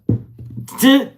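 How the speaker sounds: high burst of laughter. Real, not acted. Laughing at herself over snacks.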